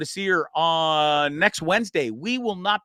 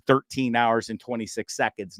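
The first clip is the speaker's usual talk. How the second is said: ordinary delivery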